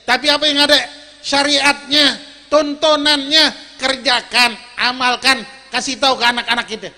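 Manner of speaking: loud preacher's delivery, pressing each point; emphatic preaching. Real, not acted. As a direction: proclaim it forcefully to the congregation. (berceramah lantang dan tegas)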